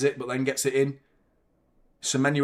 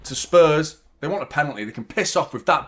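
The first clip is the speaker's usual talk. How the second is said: normal talking voice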